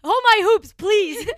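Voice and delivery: high, loud hammed-up fighting talk; mock tough. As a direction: square up like you're about to brawl. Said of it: "take my earrings off".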